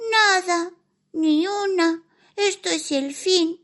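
high squeaky complaining mouse; whiny little mouse. Acted. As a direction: moan like a tiny mouse. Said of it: "una vocecita que se quejaba constantemente".

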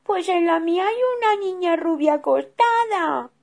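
high, loud cub's cry; a small bear telling on the girl; shrill-free high child's voice, loud; little bear voice. Acted. Cub reports a girl.

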